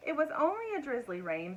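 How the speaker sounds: ordinary delivery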